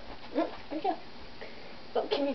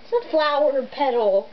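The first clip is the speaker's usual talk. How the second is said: ordinary talk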